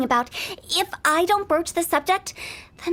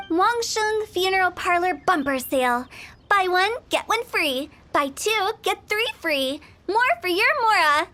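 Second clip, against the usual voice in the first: normal talking voice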